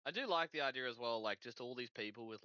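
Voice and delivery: usual speaking voice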